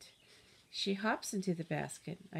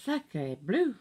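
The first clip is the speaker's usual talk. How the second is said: French exclamation; 5 dB louder than usual. Acted.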